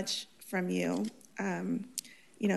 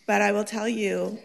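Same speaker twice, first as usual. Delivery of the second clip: ordinary talk, nothing marked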